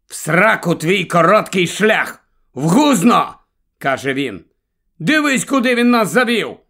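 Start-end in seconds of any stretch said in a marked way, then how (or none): 0.1-3.3 raised, emphatic outburst; angry cursing. A narrator's reading. Grandfather cursing his son's shortcut, angry outburst. 5.0-6.6 high, raised exclamation of outrage; angry complaint. A narrator's reading. Grandfather blaming his son for the detour, indignant.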